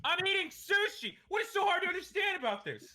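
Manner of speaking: high, loud, exasperated yell; mock angry shout. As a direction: play up outrage, loud and high. Mock outrage at friend.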